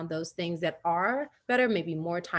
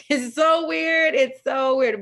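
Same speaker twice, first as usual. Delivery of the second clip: laughing; 6 semitones higher, 7 dB louder, slower than usual. Real, not acted. Laughs at her own story.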